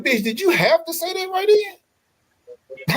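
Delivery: high, slow, exasperated put-on voice; mock exasperated. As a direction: drag it out high like you can't believe she said it. His inner reaction, mock exasperation.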